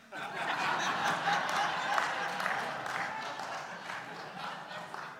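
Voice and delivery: long voiceless laugh; silent laugh. Real, not acted. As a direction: laugh with almost no voice, shaking. Laughing at not being missed.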